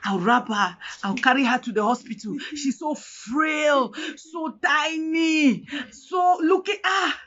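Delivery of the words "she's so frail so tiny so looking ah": slow, stretched-out words, pitch climbing; drawn-out lament. Retold or imitated. Mother describing her frail baby at the hospital, slow drawn-out lament. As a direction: drag out each description in dismay. Dwells on how tiny baby was.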